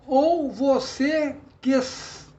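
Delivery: very slow, punched beats with "quis" as one; syllable by syllable. Shown as a demonstration. Teacher showing merged syllables, syllable by syllable. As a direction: count out "Ou você quis" beat by beat. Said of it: "você fala numa sílaba só".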